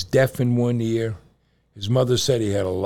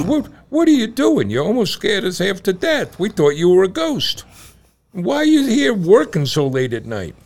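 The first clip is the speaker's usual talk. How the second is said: high, loud frightened outburst; frightened yelling; 10 semitones higher, 7 dB louder than usual. Acted. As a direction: shout it like you just saw a ghost. Frightened Joseph confronts old man.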